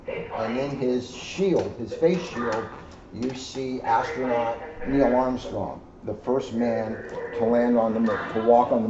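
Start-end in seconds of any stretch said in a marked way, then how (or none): none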